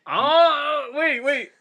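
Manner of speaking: high, loud excited outburst; real excitement at the twist. Real, not acted. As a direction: jump in with eager excitement. Hearing the toy prize is found.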